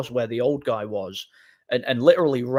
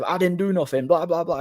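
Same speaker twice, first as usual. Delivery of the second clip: higher, half-hearted imitation of his yelling; mock protesting shout; 6 semitones higher than usual. Attacker yelling his innocence at the narrator, high mock shouting. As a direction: mimic him denying it all, pitched up. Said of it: "shouting at me in gibberish".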